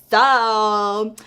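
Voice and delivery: loud, drawn-out mock-patient opener; explaining to a child. Friend explaining to the storyteller like a child, mock-patient. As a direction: imitate someone stretching a word for a slow listener. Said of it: "explain this to me like I was a child".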